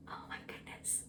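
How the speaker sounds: a breathless whispered oh my goodness; awed whisper. Real, not acted. Overcome by her first jar of jam, awed whisper. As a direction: whisper it as if you can't believe it.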